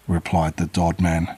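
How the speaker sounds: ordinary delivery, nothing marked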